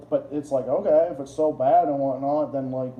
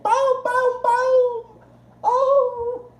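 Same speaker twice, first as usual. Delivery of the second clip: much higher booming thuds and a cry; mock booms; 4 dB louder, much slower than usual. Describing the rough highway, hammed-up high booms. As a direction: ham up the bangs. Imitating road noise again.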